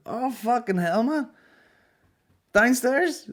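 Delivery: high, loud, excited Belfast bloke voice; mocking the bar guy. Comedian mimics a rude bar guy recognising him, high and loud. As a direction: ham up a nosy punter spotting a comic. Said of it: "this guy's like".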